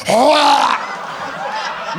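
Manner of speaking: choking retch, hammed-up; mock gag. Girl gagging on him, hammed-up retch. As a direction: fake a gag with a cry. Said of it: "She's like".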